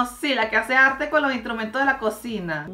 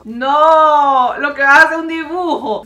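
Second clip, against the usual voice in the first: outraged shout; 3 semitones higher, 10 dB louder than usual. Real, not acted. Complaining the egg became a drawing, loud. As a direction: protest loudly at the trick.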